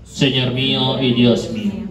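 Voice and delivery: ordinary delivery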